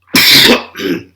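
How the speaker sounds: loud rough coughing fit; harsh cough. Real, not acted. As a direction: cough hard, chest hurting. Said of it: "I'm sick".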